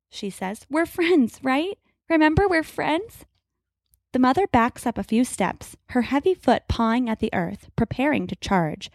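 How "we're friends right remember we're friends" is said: higher, pleading reassurance; nervous coaxing. A narrator's reading. Grace pleads with the mother hexacorn, higher and anxious. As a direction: talk the beast down, uneasy.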